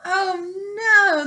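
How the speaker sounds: usual speaking voice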